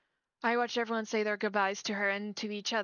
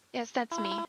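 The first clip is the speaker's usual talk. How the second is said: normal talking voice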